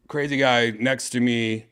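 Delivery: ordinary talk, nothing marked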